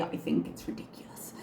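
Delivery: whispered dismissive aside. Real, not acted.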